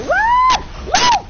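high, loud yell of alarm; panicked yell. Real, not acted. Fleeing in game, nearly dead.

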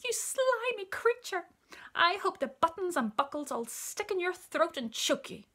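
high monkey scolding; outraged cry. A narrator's reading. Monkey curses the child-eating crocodile, high outrage. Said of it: "cried Mugglewump".

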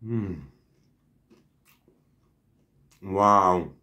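mumbling through food; talking mid-chew; chewing with mouth full. Real, not acted. Seen 2: cheeks full, chewing after big bite, burger in hand.

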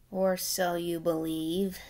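hint at the trick darkly; ominous aside. Acted. Narrator warning about Matilda, soft ominous aside. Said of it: "narrator ominous aside".

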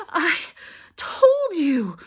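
winded, high and loud reproach between breaths; gasping. A narrator's reading. Girl with a stitch scolding friends, gasping. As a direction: gasp for air, then stretch the scolding out. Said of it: "Hermione gasped".

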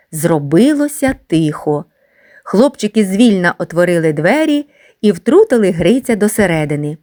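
usual speaking voice

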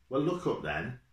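sharp push to look up; a touch of impatience; impatient driver. A narrator's reading. Driver pushes Jack.

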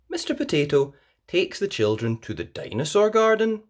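usual speaking voice

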